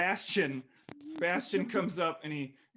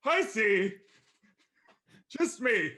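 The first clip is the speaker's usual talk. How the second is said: sulky dragon voice; 10 semitones higher, 7 dB louder, slower than usual. Acted. Dragon sulking at friends.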